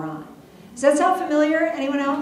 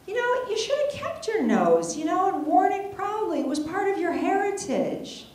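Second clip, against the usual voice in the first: husband voice; 9 semitones higher than usual. Retold or imitated. Quoting her sweet husband.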